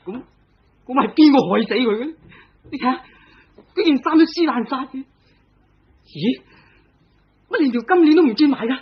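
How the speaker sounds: very high, strained agitated voice; husband frantic beside his dead wife; frantic distress. Acted. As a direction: panic over the body. Husband frantic over wife's body.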